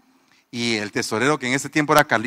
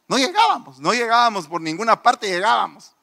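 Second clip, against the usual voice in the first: exasperated; 7 semitones higher than usual. Retold or imitated. Reliving panic over missing rent.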